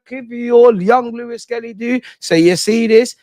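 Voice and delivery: high, building triumphant rant; excited rant. Real, not acted. Triumphant rant about the win.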